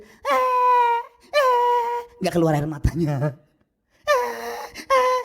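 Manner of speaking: very high fake whimpering eh eh; loud hammed-up childish whine; mock child crying. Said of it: "kayak anak kita yang kecil".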